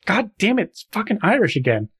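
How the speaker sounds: annoyed at oneself; frustrated. Real, not acted. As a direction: curse your own failed accent. Annoyed at own accent slip.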